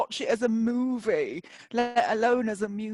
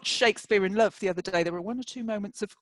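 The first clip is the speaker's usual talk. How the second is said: normal talking voice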